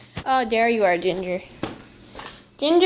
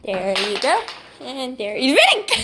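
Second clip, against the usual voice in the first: usual speaking voice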